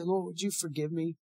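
soft, hushed plea to the Lord; modeling a private prayer, softly; quiet prayer. Shown as a demonstration. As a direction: pray it under your breath. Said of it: "in the quietness of your heart".

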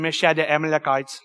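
put-on a light playful Cajun accent on "Meshad the Amalekites". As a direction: toss off the name in a mock Cajun accent. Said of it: "I'm gonna do it in my Cajun accent".

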